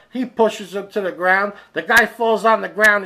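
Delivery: ordinary talk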